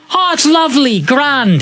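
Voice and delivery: high, lilting Irish; cousin Pat. Retold or imitated. Cousin Pat praising his pint, Irish accent. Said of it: "cousin Pat in Ireland".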